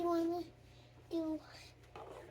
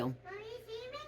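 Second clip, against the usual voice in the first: whispering while hiding; 4 semitones higher, 14 dB quieter, much faster than usual. Real, not acted. Child hiding, playing peekaboo.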